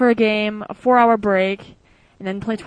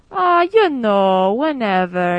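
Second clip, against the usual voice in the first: put-on easygoing Italian accent, slow and drawn out; lightly put-on Italian accent, unhurried; 3 dB louder, much slower than usual. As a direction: imitate a relaxed Italian party host, slowly. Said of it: "in German with an Italian accent".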